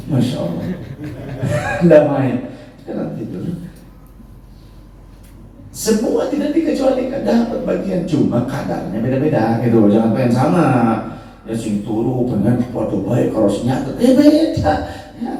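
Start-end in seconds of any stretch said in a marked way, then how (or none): 2.9-3.4 whispered aside. Real, not acted. Aside to congregation. 14.0-14.7 surprised exclaim. Real, not acted. Sudden exclaim.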